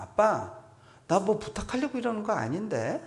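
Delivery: 높은 아이 목소리로 정색하며; 억울한 듯 높게 항변하는 아이 흉내. Retold or imitated. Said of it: "정색을 하면서". (아빠에게 정색하는 아이, 높고 진지하게)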